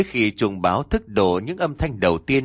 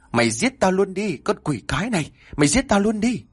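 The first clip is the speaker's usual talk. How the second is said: high, anguished scream of rage; furious scream; 9 semitones higher than usual. A narrator's reading. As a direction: scream it out in despair. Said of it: "Tôi gào lên trong sự phẫn uất". (hét lên phẫn uất)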